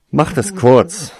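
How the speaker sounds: slow, loud impatient demand; irritated. Acted. Tarsoin snapping at the Slytherins, loud and irritated. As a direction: bite it off, losing patience. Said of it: "entgegnete er ungehalten".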